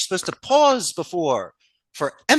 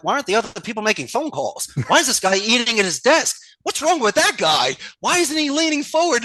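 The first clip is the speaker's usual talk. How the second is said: higher and faster, rising exasperation; upset boss rant; 8 semitones higher, 3 dB louder than usual. Retold or imitated. Boss ranting at idle call center staff, exasperated. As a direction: fire off complaints at the floor. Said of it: "someone like me that gets upset".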